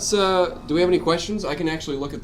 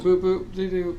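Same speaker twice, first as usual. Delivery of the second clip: higher chirpy beep-boop voice; robot beeps; 6 semitones higher, slower than usual. Acted. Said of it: "You didn't do this, though".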